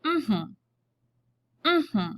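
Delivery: hummed two-beat demo; hummed word. Shown as a demonstration. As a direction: hum the word apple in two beats. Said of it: "it would sound like this".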